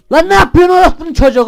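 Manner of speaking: high, loud panicked yell; real scared outburst at the screen; scared shout. Real, not acted. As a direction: shriek it out in real fright. Jump scare while gaming.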